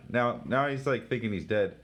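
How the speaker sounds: ordinary delivery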